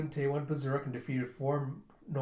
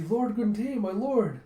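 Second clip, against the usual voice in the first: much higher, louder call from afar; 8 semitones higher, 5 dB louder, much slower than usual. Retold or imitated. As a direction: hurry in calling your lord. Judo runs up calling.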